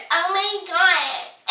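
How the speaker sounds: mock her as an overdramatic baby; mocking whine. Mocking the girl's reply.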